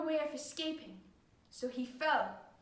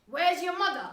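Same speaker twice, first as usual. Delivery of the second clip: put-on loud, curt question to the child; demanding father; 3 semitones higher, 6 dB louder than usual. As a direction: demand an answer from your son. Father questioning his boy.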